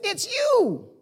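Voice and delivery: high-pitched wife voice; imitating wife. Wife greeting husband at the door, imitating her. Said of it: "My wife says".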